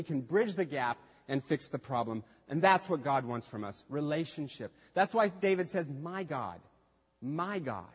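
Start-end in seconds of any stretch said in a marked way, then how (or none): 5.9-7.9 soft, low and tender; quiet intimate appeal to God; intimate cry. Retold or imitated. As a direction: whisper it as a close plea. Quoting David intimately.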